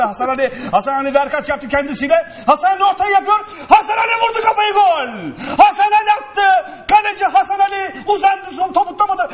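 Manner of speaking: excited commentary. Pretend. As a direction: build to a goal scream. Mock live play-by-play, goal.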